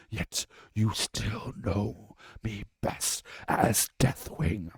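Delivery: loud, rasping monstrous voice; dragon proclaiming. Acted. Deathwing announces himself to puny mortals, loud. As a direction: boast of your dreaded names.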